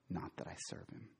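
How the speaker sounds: breathy whisper, nearly silent. Real, not acted. Pastor admitting his calling to the congregation, whispered. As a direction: let it drop to a whisper. Softly stated confession.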